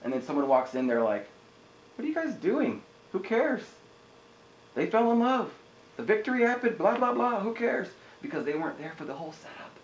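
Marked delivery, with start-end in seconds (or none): 2.0-7.9 high-pitched mock voice of a clueless intruder; dismissive latecomer. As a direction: blurt it high and scornful, imitating someone who missed everything. Clueless latecomer interrupts the film.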